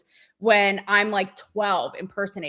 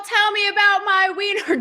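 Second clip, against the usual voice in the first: high, louder cheesy ad-man line; mock ad pitch; 11 semitones higher, 5 dB louder than usual. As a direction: ham up the cringey ad slogan. Quoting the hot dog ad line.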